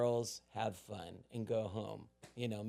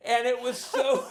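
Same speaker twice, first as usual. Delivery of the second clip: ordinary delivery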